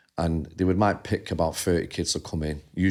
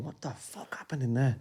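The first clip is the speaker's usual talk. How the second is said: hushed, amazed aunt; quietly shocked, voicing auntie; stunned hush; 5 semitones higher, 9 dB quieter than usual. Auntie quoted, stunned after meeting.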